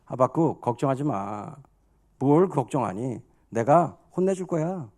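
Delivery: low, soft divine reassurance; acted God settling a worried servant; calm reassurance. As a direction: soothe them gently, pitched low. God voiced calming Habakkuk.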